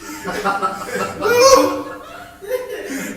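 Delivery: high burst of real laughter. Real, not acted. Preacher cracking up at his own breath story. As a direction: crack up at the bit.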